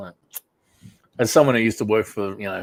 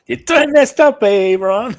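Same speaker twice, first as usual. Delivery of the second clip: much higher and louder put-on scolding voice; mock stern teacher; 13 semitones higher, 10 dB louder, slower than usual. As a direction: scold like an outraged substitute teacher. Quoting a comedy catchphrase.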